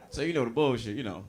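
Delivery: ordinary talk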